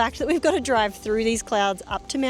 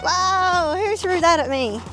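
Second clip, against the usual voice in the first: surprised; 9 semitones higher, 9 dB louder, slower than usual. Real, not acted. Snowball hits her.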